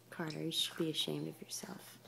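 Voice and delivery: mock-stern whispered tease. Real, not acted. Mother teasing her baby Carter, mock-stern whisper. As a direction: tease him in a hushed scold.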